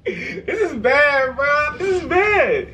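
pained laugh at a bad joke; groaning at a pun. Real, not acted. Groaning after a bad dad joke.

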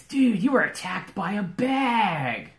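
high, loud, drawn-out dude voice; amazed bard. Acted. Bard to the bag's victim, amazed drawl. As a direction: wail it slowly in amazement.

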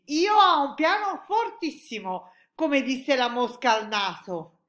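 loud, high, slow announcement; excited exclamation. A narrator's reading. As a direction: proclaim it proudly, stretching the words. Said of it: "esclamò il G G G". (annuncio esclamato, acuto e lento)

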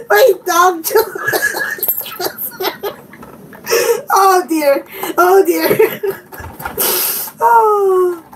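high words broken up by laughter; helpless laughing. Real, not acted. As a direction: try to speak while cracking up. Still laughing about the dog.

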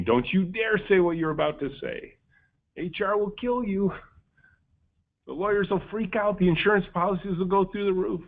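dark inner voice yelling. Retold or imitated. Boss's fearful inner voice warning him off, mock alarmed yell. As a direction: play the panicky voice of caution in your head. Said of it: "the dark voice was yelling in my ear".

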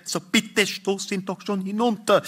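high-pitched urgent plea; pleading wife. Acted. Wife begging her husband to push him, high pleading voice.

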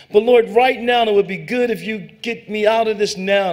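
high, loud pressing appeal; urgent plea. Retold or imitated. Preacher arguing with God in prayer, loud urgent plea. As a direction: push God hard for a quick rescue.

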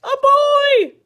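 high, very loud frightened yell. A narrator's reading. Bear sees the boy, yelling. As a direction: yell it at the top of the range. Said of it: "yelled the bear".

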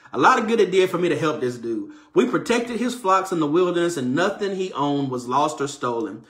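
ordinary talk, nothing marked

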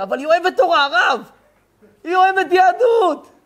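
excited pleading, building to a high cry; eager plea. Retold or imitated. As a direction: push it like a man begging the rabbi to understand. Man pleads his wife loves Torah.

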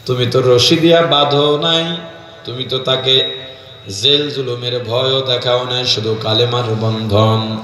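low, plaintive sing-song narration; melodic preaching. Real, not acted. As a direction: intone the bride's hardship low, lingering on endings. Preacher intones bride's story. (নিচু করুণ সুরে ওয়াজ)

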